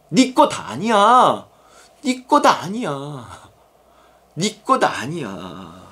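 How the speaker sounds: drawn-out words, stepping down in pitch; firm correction. Acted. God answering a possessive believer, slow firm correction. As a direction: say it very slowly, sinking with each repeat.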